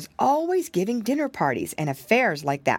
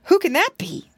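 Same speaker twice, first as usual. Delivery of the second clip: high, louder anxious question; shivering; 11 semitones higher, 5 dB louder than usual. A narrator's reading. Piglet asking who is at the door, shivering. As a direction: ask it high, as if shivering with fright. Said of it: "he shivered".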